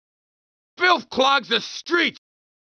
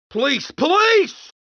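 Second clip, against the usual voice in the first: loud, drawn-out call for police; shouting for help; 7 semitones higher, 3 dB louder than usual. Acted. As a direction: yell it out, desperate. Cabbie calling for police.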